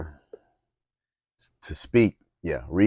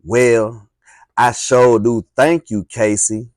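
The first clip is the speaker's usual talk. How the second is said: sassy, louder thank-you with a lift on Casey; with attitude; 4 semitones higher, 8 dB louder, slower than usual. Shown as a demonstration. Girl thanks Casey, with attitude. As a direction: give the thanks some real sass. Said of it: "let me say that with some attitude".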